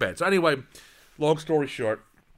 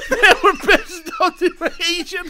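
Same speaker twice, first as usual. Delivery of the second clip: helpless laughter; 5 dB louder than usual. Real, not acted. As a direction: labour through the line while cracking up. Repeats line while laughing.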